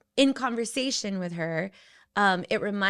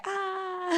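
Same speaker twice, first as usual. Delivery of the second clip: mock scream; 11 semitones higher than usual. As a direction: imitate a movie kid's long "Ah". Imitates the Home Alone scream.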